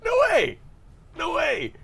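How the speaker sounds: high, loud squeal of disbelief; amazed disbelief. Acted. Viewer spotting now-famous actors in an old film, amazed disbelief. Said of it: "you watch it, and you're like".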